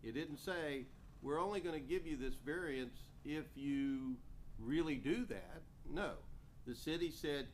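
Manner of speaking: emphatic rebuttal. Real, not acted. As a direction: argue it firmly and forcefully. Official firmly rebuts the owner's claim.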